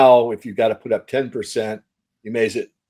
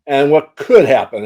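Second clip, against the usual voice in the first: could hit higher and louder than the words around it; stress on could; 4 semitones higher, 6 dB louder than usual. Real, not acted. Analyst stressing to his interviewer that it could happen, with could punched. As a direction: lean hard on could, as a possibility, not a certainty. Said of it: "I want to emphasize the word could".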